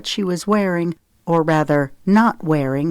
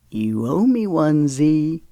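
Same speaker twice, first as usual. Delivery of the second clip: usual speaking voice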